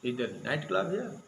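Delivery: slow question, climbing on "club here"; question tune. Retold or imitated. Newspaper version of the Pope's words, read as a question. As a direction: stretch "night club here" upward as a query. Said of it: "he asked the question".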